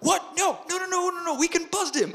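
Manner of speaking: very high, rapid mock-panicked pleading; frantic protest. Two rookie cops begging not to drop the case, frantic. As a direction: imitate eager rookies protesting, squeaky and rushed.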